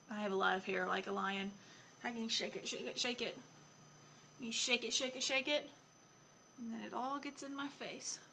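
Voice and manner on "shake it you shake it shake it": plain reading voice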